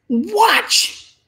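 loud, high, punched single word; sudden warning. Real, not acted. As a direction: call it out sharply to grab the room. Preacher's sudden warning call.

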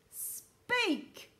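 high, loud, drawn-out command; booming shout. A narrator's reading. Houston demands a reply from silent strangers, booming shout. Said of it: "boomed the voice".

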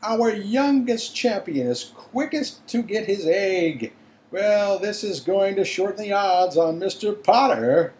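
high, loud commentator voice; excited announcer. A narrator's reading. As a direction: yell it out to the crowd. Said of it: "Bagman was yelling".

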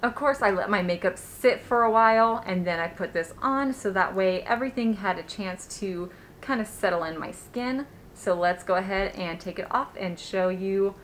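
muffled behind cloth mask; through a face mask. Real, not acted. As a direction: mouth covered by fabric. Seen 0: Cloth mask covers nose and mouth throughout; removed by 11.9.

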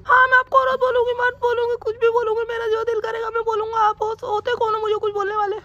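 high falsetto wife scolding; mimicking wife. Husband mimics his wife accusing him, high falsetto. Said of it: "mimicry कर रहा हूँ मैं".

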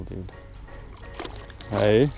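normal talking voice

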